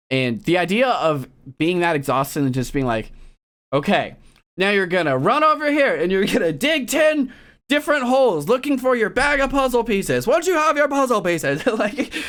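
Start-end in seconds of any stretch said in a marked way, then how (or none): 3.7-11.7 mock host instructions. Voicing host's challenge instructions.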